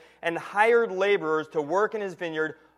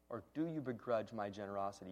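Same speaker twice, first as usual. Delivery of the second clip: "Or do you begrudge my generosity?" repeated much softer and lower; quiet repeat; 8 semitones lower, 18 dB quieter than usual. Shown as a demonstration. Preacher repeats the verse to let it land, hushed. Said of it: "Now listen to this next phrase".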